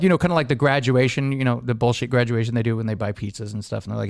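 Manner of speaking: ordinary talk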